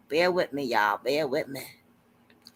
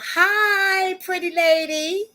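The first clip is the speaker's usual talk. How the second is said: sweet greeting; 11 semitones higher, 4 dB louder, much slower than usual. Real, not acted. Greeting a new guest.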